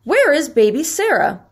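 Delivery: mildly alarmed; alarmed cry. A narrator's reading. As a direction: call out as a worried storybook mother. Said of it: "Mother cried".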